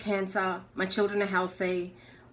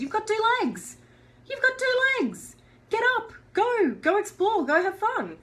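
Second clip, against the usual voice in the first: much higher, bright mock pep-talk voice; cheerful self pep talk; 13 semitones higher than usual. Injured woman rallying herself out of bed, high excited pep talk. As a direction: cheer yourself out of bed like a coach.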